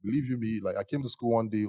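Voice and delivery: usual speaking voice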